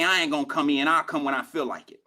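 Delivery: imitating a lazy employee calling in; lazy worker calling off. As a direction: drop it low like a shrugging no-show worker. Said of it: "Call your job and say".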